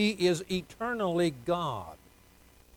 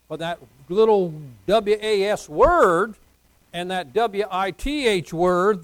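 letters W A S and W I T H spelled one by one, louder; 3 semitones higher, 7 dB louder than usual. Shown as a demonstration. Pastor spells out was and with for emphasis. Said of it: "spells out key words".

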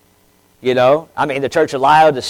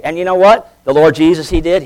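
normal talking voice

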